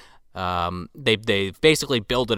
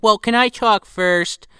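high, slow imitation of a girl; daughter's voice; 9 semitones higher, slower than usual. Daughter interrupting her father, imitated high voice. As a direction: lift it into a drawn-out daughter voice. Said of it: "And she's like".

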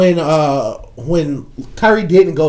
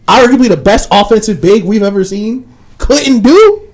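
raised, loud build to COULDN'T DO; emphatic challenge; 5 semitones higher, 6 dB louder than usual. Real, not acted. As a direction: hammer "couldn't do", higher and louder. Arguing nobody can post up Draymond.

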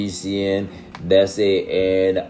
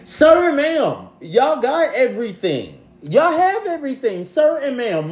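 much higher, exasperated sassy voice; high sassy retort. Acted. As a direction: tell them off in a high sassy put-on voice. High-pitched retort to commenter.

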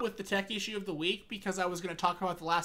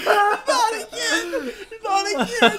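high, slow, loud laughing cry; laughing hysterically; 13 semitones higher, 10 dB louder, slower than usual. Real, not acted. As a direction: wail it through helpless laughter. Laughing helplessly.

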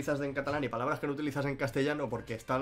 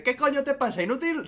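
higher, louder hammed-up Vegeta yell; Vegeta insult; 11 semitones higher, 5 dB louder than usual. Imagining an uncensored Vegeta line for the chat, mock shout. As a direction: bark the insult like a furious cartoon villain. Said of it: "que Vegeta le dije".

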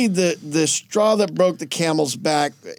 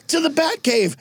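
high-pitched mock-heroic cry; rally your sidekick, hammed up; Batman rallying call; 10 semitones higher than usual. Batman's battle cry to Robin.

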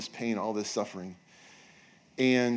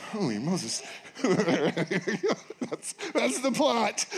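laughing disbelief; 7 semitones higher, much slower than usual. Real, not acted. Pastor laughing at the movie plot, amused. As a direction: laugh through the punchline.

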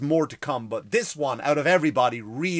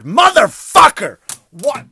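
huge high yell of a curse; shouted curse; 12 semitones higher, 17 dB louder, slower than usual. Real, not acted. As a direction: blurt it out at the top of your lungs. Sudden outburst at the list.

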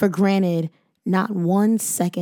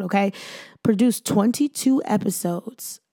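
ordinary delivery

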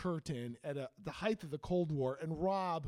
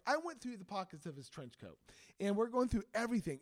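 ordinary delivery

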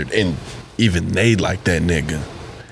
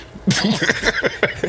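incredulous laugh; 11 semitones higher than usual. Real, not acted. Laughs mid scolding.

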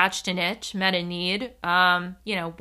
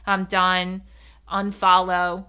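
louder, level-toned brush-off; triggered dismissal; 7 dB louder, slower than usual. Acted. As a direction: imitate a reactive commenter cutting you off. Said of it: "only thing that can come out".

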